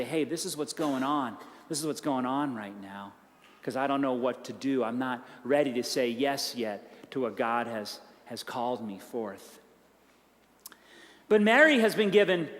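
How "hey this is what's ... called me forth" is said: low, soft troubled confession to a trusted friend; hushed confiding. Retold or imitated. A worried believer confiding in a mentor, soft and low. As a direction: murmur it like a confession you can barely admit.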